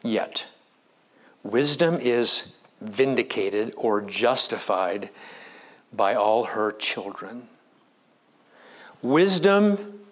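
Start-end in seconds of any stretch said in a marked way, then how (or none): 0.0-7.5 scripture reading. Acted. Reads scripture verse slowly.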